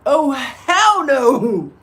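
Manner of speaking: hammed-up refusal, slow and loud; mock outraged refusal. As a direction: stretch it out in loud disbelief. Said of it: "What do you think I would have said?".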